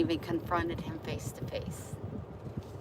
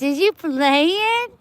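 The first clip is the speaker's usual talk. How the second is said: high, slowed cutesy question; 8 semitones higher, 9 dB louder, much slower than usual. Real, not acted. Asking the cat if it is playing. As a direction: stretch it out like talking to a kitten.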